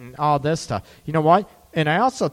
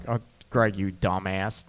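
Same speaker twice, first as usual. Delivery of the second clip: self-mocking low mutter; 8 semitones lower, 7 dB quieter than usual. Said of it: "And I'm like".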